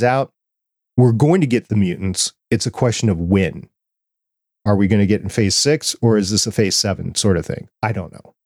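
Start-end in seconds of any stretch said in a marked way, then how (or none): none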